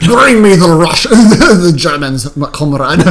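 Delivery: hammed-up Russian commander, slow; slow laughing mock Russian; mock Russian accent. Joking Russian movie voices.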